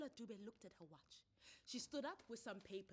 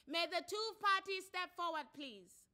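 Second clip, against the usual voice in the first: loud, high formal court opening; magistrate's formal announcement; 11 semitones higher, 14 dB louder than usual. Acted. Magistrate announcing the paternity case, loud and formal. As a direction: open the case with authority.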